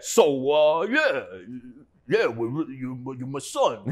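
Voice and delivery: a light take on his father's Italian-American way of talking, slow at the end; lightly voiced father figure, drawn out; imitating his father. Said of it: "He talks like this".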